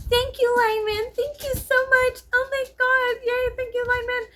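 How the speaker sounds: mock the swooning fans; high-pitched gushing fangirl voice mocking online praise.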